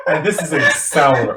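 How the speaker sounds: bitter laugh into the words. Real, not acted. Laughs at sour topic.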